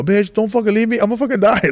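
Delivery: high, frantic begging in fear; panicked plea. Retold or imitated. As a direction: beg her not to leave, terrified. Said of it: "Like, I'm scared".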